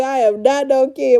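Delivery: usual speaking voice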